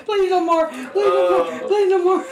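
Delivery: high, begging through laughter; high pleading. Real, not acted.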